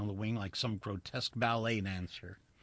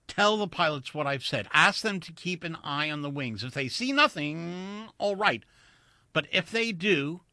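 higher, insistent plea; pleading; 6 semitones higher, 4 dB louder than usual. A narrator's reading. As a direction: urge her to pass the warning on. Urgent plea to stewardess.

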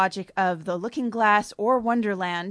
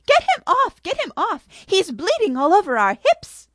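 much higher and louder shriek; shrilling; 12 semitones higher, 9 dB louder than usual. Acted. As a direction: shriek it in disgust.

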